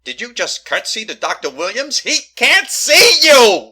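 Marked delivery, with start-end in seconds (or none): 2.0-3.7 slow, loud, high exasperation; exasperated shout. Acted. Woman yelling at the polite ghost, slow and loud. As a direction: spell it out to the ghost.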